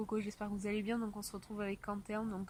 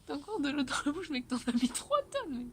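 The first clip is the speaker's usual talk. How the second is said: laughing; 5 semitones higher, 6 dB louder than usual. Real, not acted. Teasing friend with full mouth.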